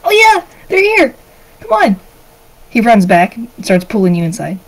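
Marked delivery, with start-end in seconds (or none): 0.0-2.0 put-on very high, eager kid; high-pitched boy calling them along; excited little boy. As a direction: urge the visitors in like a thrilled child. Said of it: "he's all happy and excited".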